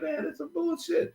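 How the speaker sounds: high, soft, fed-up complaint; disgusted aside. Retold or imitated. Turning away from the kissing pair, a high, disgusted complaint. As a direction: toss it off, high and quiet, in disgust. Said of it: "You gotta turn around like".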